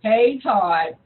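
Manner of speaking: low, heavy greeting; unexcited caller. Retold or imitated. Voicing Todd mocking the caller's greeting, low and glum. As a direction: imitate a caller who wants something. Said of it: "Then you call me with".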